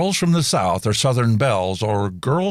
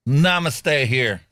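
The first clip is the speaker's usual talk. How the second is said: Southern namaste; 2 semitones higher, much slower than usual. Retold or imitated. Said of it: "as in".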